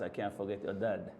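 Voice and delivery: normal talking voice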